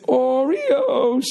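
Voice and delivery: high, long-held singsong call; echoing cleaner impression. Cleaner's call rings round the lobby, high and drawn out. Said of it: "echoed when he did it".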